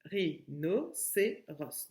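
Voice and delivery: "Ri, no, c'est ros" in short separate beats; small gap after each syllable in "Ri, no, c'est ros". Shown as a demonstration. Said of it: "la syllabe no".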